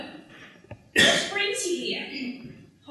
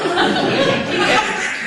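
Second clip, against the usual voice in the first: loud laugh; 5 semitones higher, 8 dB louder than usual. Acted. Laughs about her school Mikado.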